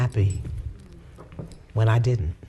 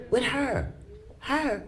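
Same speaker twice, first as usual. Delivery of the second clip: incredulous; 12 semitones higher, 6 dB quieter, slower than usual. Retold or imitated. Incredulous dialogue with the Spirit.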